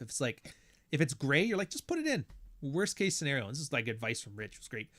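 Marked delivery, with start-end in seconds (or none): 1.7-2.3 high squeaky just-do-it voice; mock high-pitched prompt; high mock urging. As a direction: nudge in a high playful voice. Imitating inner voice on taxes.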